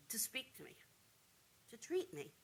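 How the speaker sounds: breathless whisper, almost no voice; whispered plea. Real, not acted. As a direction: drop to a pleading whisper. Begging the doctor to speak.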